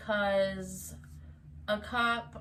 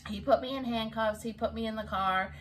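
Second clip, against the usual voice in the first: ordinary talk, nothing marked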